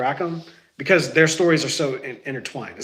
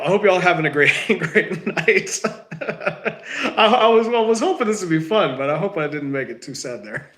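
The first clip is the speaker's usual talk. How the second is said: words broken by real laughter; laughing while talking; 5 semitones higher than usual. Real, not acted. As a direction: laugh through the goodbye. Laughing at his lost voice.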